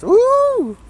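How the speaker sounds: high, loud excited woo; excited whoop. Real, not acted. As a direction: let out a thrilled whoop. Excited about the game.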